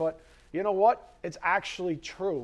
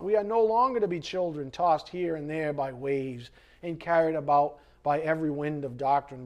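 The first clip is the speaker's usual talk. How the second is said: usual speaking voice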